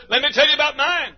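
high woman's voice telling her loss; grieving mother. Retold or imitated. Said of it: "the other person says".

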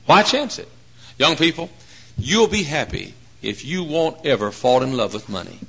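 ordinary talk, nothing marked

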